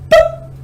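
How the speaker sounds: a sudden high-pitched boop for the elbow popping; high pop sound. Retold or imitated. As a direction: squeak it out like a cartoon pop. And it went.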